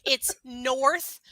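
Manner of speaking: "Northport" slowly, both R's pronounced; R's fully sounded. Shown as a demonstration. Co-host teasing him about the town name, R's fully sounded. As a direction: stretch out "Northport" with clear R sounds. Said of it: "you don't use your R's".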